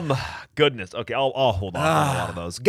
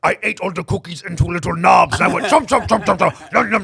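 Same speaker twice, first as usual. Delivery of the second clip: higher puppet monster munching; Cookie Monster voice; 7 semitones higher, 7 dB louder than usual. Acted. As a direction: chomp like a monster. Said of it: "Cookie Monster".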